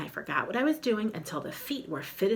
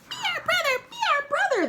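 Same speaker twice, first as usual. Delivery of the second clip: very high, loud squeaky chant; tiny spiders chanting at him; high squeaking spider chorus; 5 dB louder than usual. Acted. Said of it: "they squeaked".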